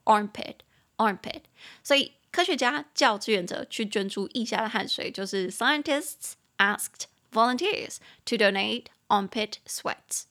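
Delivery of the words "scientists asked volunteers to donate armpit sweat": 放慢语速清楚朗读; 逐词慢读示范; slow model reading. Shown as a demonstration. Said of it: "teacher reads sentence slowly". (老师慢速示范朗读)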